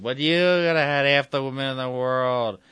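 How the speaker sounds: a hint of a bitter drunk; drunken ranting. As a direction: [name] ranting at Darth Fable. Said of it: "[name] continues talking".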